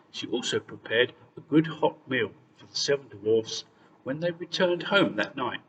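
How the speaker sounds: ordinary delivery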